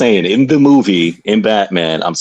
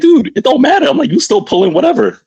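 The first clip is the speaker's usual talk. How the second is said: high excited. Retold or imitated.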